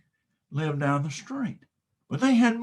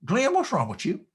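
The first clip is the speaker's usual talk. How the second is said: cow voice; 7 semitones higher than usual. Acted. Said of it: "looks at me with those big brown cow eyes and says".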